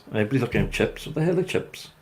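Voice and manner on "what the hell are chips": a hint of a baffled yokel, higher and softer; puzzled villager. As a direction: lightly voice a confused local seeing chips.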